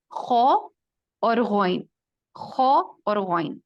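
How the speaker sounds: teacher drilling خو and غین for practice; slow pronunciation drill. Shown as a demonstration. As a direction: model خو اور غین slowly and clearly. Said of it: "اس کو ضرور practice کریں".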